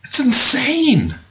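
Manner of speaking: high-pitched, drawn-out exclamation; exasperated disbelief. Real, not acted. Preacher reacting to believers' excuses, exasperated.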